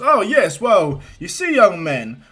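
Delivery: loud, high hero bluster; All Might. Acted. Hero explaining himself to a boy, loud and hearty. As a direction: boom it out heartily, falling at the end. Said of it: "And he goes".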